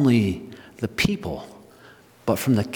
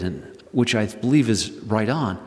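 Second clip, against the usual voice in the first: normal talking voice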